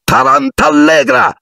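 high, slow spell cry; shouted spell. A narrator's reading. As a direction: hurl the curse. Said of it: "gridò". (incantesimo gridato)

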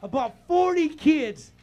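loud, high, driving preacher's cry; full-force preaching. Acted. Pastor preaching hard to the congregation, full force. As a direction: preach it out at the top of the lungs.

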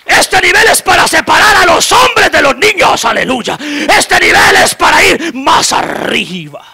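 high, loud, crying shouted sermon; fiery revival preacher; full-force preaching. Real, not acted. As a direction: preach it at the top of your lungs.

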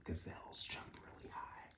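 soft whispered wonder; hushed marvel at the gazelles. Real, not acted. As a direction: whisper it like a secret. Whispered asides about gazelles.

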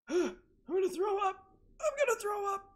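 panicked squeal. Real, not acted. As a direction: squeal in real fright. After a scare.

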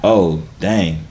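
ordinary delivery

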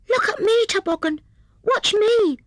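very high little hedgehog voice; cried out. A narrator's reading. Little hedgehog showing off to the sledgers, cried out. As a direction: squeal for everyone's attention. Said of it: "cried Fuzzy Peg".

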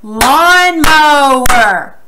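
"Lawnmower" very slow and loud, in three beats; syllable by syllable. Shown as a demonstration. Teacher leading slow claps, "Lawnmower" syllable by syllable. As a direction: stretch and punch each syllable of "Lawnmower". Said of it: "don't clap too fast".

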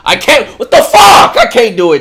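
high, loud burst of protest; distressed shout. Real, not acted. Unable to watch Dante cry, distressed high shouting. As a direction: yell it out in overwhelmed protest.

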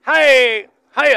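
sharp loud hey; shout. Real, not acted. Rancher shouting at the bull, loud. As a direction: yell to get their attention.

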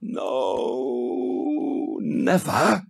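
high, crying refusal in a strange voice; frightened refusal. Acted. Hindele refuses the giant who tempts her, frightened and crying. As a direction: cry out against the demon.

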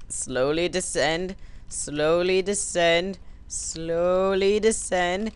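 drawn-out, slowly stretched words; slow careful coaxing. Acted. Player easing his rider down the drop, slow coaxing. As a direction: stretch each word out, stretching further each time.

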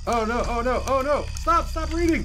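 high, loud panicked shrieks; frantic, begging it to stop. Real, not acted. Monster catches her reading.

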